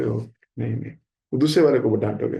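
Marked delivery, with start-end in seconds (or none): 0.0-1.4 lower, softer, overly polite no no; a soft courteous refusal; polite soft brush-off. Retold or imitated. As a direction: wave it away gently without scolding.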